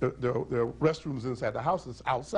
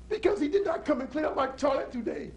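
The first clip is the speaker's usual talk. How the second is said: wailing cry; 12 semitones higher than usual. Acted. Punchline wailed to crowd.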